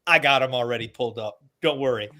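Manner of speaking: usual speaking voice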